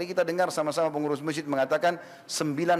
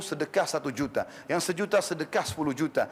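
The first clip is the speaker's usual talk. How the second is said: ordinary delivery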